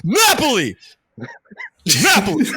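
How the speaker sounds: high, loud mock Italian yell; waiter's shouted answer. Imitating the waiter finally answering across the restaurant, high shout. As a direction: holler it back like an exasperated waiter. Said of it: "the guy turns around and goes".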